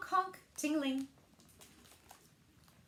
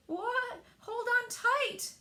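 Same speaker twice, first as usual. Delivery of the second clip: calling out; 12 semitones higher, much slower than usual. A narrator's reading. Dad calls after the bike.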